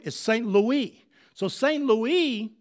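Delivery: normal talking voice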